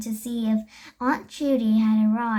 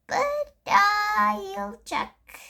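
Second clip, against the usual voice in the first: lady voice; 8 semitones higher, much slower than usual. A narrator's reading. Lady character in story.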